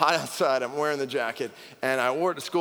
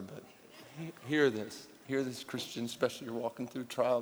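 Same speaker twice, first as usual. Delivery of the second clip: usual speaking voice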